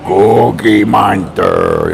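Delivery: slow lightly voiced monster name; Cookie Monster. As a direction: drag it out monster-like. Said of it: "You sound like Cookie Monster".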